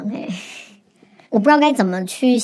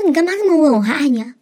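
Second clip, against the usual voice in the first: startled reply; 6 semitones higher, 3 dB louder, faster than usual. Retold or imitated. Retold startled reply to boyfriend.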